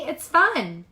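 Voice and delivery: playful friend's call. A narrator's reading. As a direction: tempt her onto the ice. Sarah calling from ice.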